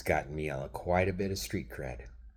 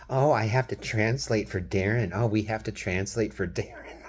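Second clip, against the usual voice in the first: higher, louder snooty mimicry; mocking impression; 5 semitones higher, 6 dB louder than usual. Imitating his wife mocking him to family, loud mocking voice. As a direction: parrot her condescending tone. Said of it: "And then you go".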